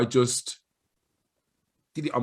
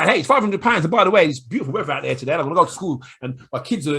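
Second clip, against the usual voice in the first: fast, louder rambling pitch; rambling salesperson; 4 semitones higher, 7 dB louder, faster than usual. Retold or imitated. Mocking a seller who keeps talking after the price, fast and louder. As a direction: rush on without drawing breath. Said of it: "'Cause if you say".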